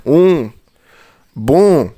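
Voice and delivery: بون as 'bõ', the n silent; nasal demo. Shown as a demonstration. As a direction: call out بون with a nasal ending. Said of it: "شفت النطق بقى هنا؟".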